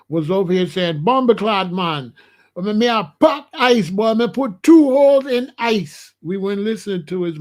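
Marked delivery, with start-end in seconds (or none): 1.0-6.1 loud, higher mock Jamaican accent; hammed-up Jamaican patois brag. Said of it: "If he's a Jamaican, he hiding his accent".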